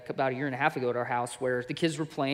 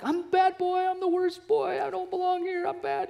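put-on much higher, a child wailing he is the worst; upset little boy. Son running off after hurting his sister, high child wail. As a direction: voice the guilt-stricken little boy, high and pleading. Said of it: "Levi ran off screaming because he's like".

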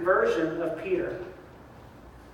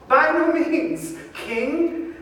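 slow mock refusal; 7 semitones higher, much slower than usual. Peter refusing God, slow mock defiance. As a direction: stretch the refusal out mockingly. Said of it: "But he says".

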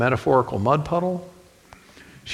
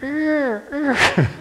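tiny squealing screams, much higher; mock plant screams; 4 dB louder than usual. Overfed houseplants dying in the house, hammed-up high squeals. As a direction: voice the dying plants shrieking in agony. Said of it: "little screams of".

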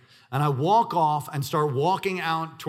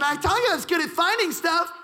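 put-on much higher, louder proud child; proud kid; 4 dB louder than usual. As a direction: boast it like a little kid. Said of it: "he said".

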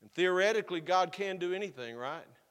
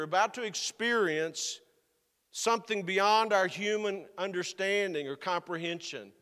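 preaching with rising force, louder; 4 semitones higher, 5 dB louder than usual. Acted. Preacher stirring his congregation, rising forceful preaching. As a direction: lean into it like a preacher at the pulpit.

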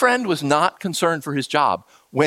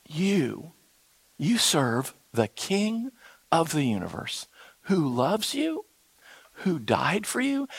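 tender; 8 dB quieter, slower than usual. Real, not acted. Tender turn in sermon.

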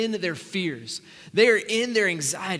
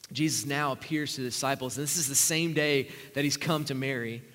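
usual speaking voice

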